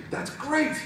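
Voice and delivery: very high-pitched, hopeful squeal; elated. Acted. Rainbow Dash hearing of an alibi, elated. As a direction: cut in with delight.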